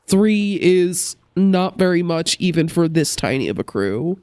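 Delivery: usual speaking voice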